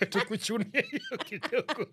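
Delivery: slow, giggling aside; laughing. Real, not acted. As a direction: talk through a laugh. Joking about pocketing the pay.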